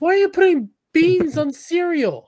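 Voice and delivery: high, loud shocked voice; mock American outrage. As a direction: squeal it in mock disgust. Mock outrage at beans on cereal.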